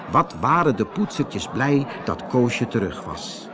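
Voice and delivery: ordinary delivery, nothing marked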